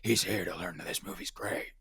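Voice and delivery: mock Jigsaw whisper; Saw voice. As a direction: menace the guest like a horror villain. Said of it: "Talk in the Saw voice".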